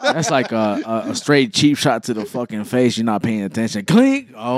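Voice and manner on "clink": high, ringing clink noise; mock metal clink sound effect; imitated helmet clink. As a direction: make the sound of a helmet getting hit.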